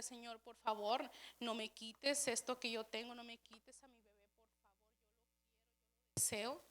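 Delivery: soft, fading plea to the Lord; retold pleading prayer. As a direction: beg quietly, voice sinking away. Retold tearful plea to God.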